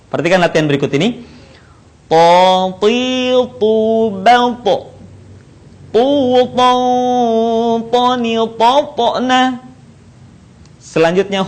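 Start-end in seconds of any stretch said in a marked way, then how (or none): 2.1-9.6 To, ti, tu chanted long, with a bounced final to; bouncing thick To. Shown as a demonstration. Teacher models practice, sustained thick chanting of To, ti, tu. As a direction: hold each syllable, bounce the closed to.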